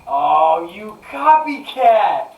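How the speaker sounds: loud, drawn-out playful accusation; high, loud teasing protest; mock outrage. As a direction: cry out in mock indignation, high and slow. Playful accusation over same dog answer.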